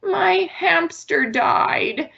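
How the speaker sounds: put-on high, slow, sad little kid; lightly voiced sad child. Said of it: "imagine your child comes to you and says".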